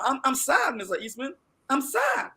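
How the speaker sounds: ordinary talk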